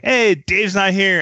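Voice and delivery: high, drawn-out mock greeting; retold cheesy fan greeting; blurted catchphrase. Retold cringeworthy greeting of Cheech.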